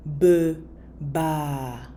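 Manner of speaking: Biba broken into "buh... baah", each part drawn out; syllable by syllable. Shown as a demonstration. Teacher models it for learners, Biba split slowly into two syllables.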